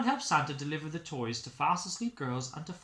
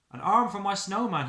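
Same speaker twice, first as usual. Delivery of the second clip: louder, higher delighted call; cheerful boy; 6 semitones higher, 10 dB louder than usual. Acted. Boy finds an arm for his snowman, delighted. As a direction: claim it happily.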